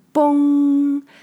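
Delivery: high drawn-out gong sound; gong imitation. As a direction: ring it out like a struck gong. Imitating a gong being struck.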